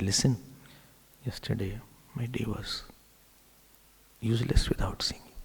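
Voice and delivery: soft whispered endearment; hushed loving murmur; tender whisper. Retold or imitated. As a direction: breathe it to her gently. Krishna's tender words whispered.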